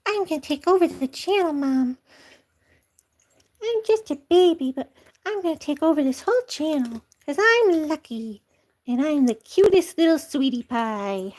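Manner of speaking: high, cheeky kitten voice; the cat Lucky telling Mom he is taking over. Pretend. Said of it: "He says".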